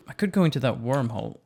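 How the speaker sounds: ordinary talk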